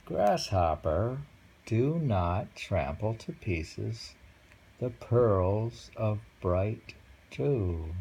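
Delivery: very slow, savoured haiku reading; poem recital. Acted. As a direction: let each word hang. Rereads the haiku.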